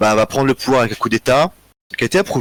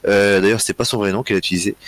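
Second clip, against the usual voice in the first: ordinary delivery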